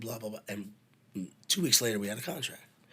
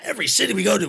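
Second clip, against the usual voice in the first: loud, much higher outburst; exasperated rant; 10 semitones higher, 11 dB louder, much faster than usual. Retold or imitated. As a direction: vent about all the keys. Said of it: "He goes".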